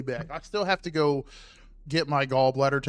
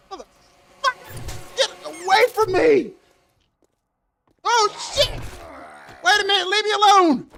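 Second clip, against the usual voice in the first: high, loud frantic shouting; panicked yelling; 5 dB louder than usual. Real, not acted. Streamer scrambling from a game monster, yelling in panic. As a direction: scream at the thing to get away.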